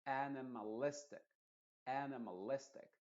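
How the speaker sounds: animalistic with stressed AN and LIS. Shown as a demonstration. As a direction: demonstrate animalistic, marking its first syllable and LIS. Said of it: "las dos que tienes que enfatizar es A". (animalistic acentuando AN y LIS)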